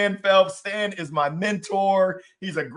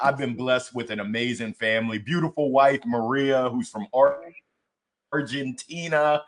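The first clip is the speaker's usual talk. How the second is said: ordinary talk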